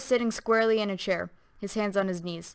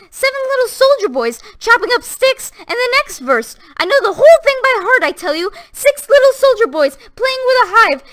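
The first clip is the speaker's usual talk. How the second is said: much higher, loud, unhinged; ranting as if gone mad; high unnatural voice; 9 semitones higher, 11 dB louder than usual. A narrator's reading. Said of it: "She went on in that high, unnatural voice".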